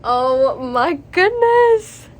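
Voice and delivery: slow, loud, stretched-out oh my goodness; awestruck gasp. Real, not acted. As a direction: let the shock hang on each word. Reader reacts to twist.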